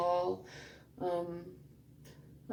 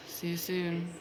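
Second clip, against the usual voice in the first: ordinary talk, nothing marked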